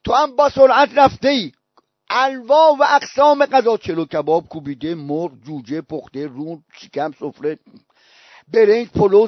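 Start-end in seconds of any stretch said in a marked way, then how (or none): 3.8-7.6 low, soft run through the dishes; hushed listing. Real, not acted. Preacher listing the banquet's dishes, hushed and low. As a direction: drop the voice and list each dish.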